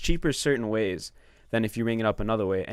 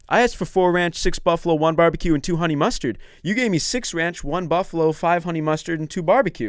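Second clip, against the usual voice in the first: higher, pressed listing of a wrong order; mock angry complaint; 6 semitones higher, 5 dB louder than usual. Sauce customer at the window listing mistakes, mock indignant. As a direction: hammer out each item as an imitated indignant customer. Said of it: "he would get so angry".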